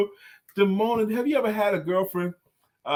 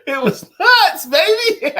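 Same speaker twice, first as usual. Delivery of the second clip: gleeful punchline; 12 semitones higher, 8 dB louder, slower than usual. Acted. Punchline repeated laughing.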